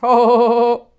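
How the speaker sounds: one loud burst of a chuckle; belly chortle, loud; a big cheeky guffaw. Acted. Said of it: "dann gluckste er richtig".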